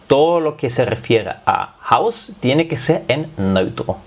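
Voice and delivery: usual speaking voice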